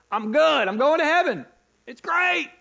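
high, loud pleading questions to God; frustrated believer. Acted. Believer asking God where he is, high and loud. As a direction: cry out to God, lost and upset.

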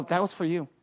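low, soft, sly jab at the husband; mock pointed aside. Wife needling her husband after church, sly undertone. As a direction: slip the dig in under your breath.

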